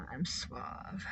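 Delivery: hushed, barely voiced smooth talker; mock suave. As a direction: whisper it like a would-be charmer. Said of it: "I went to Suave school".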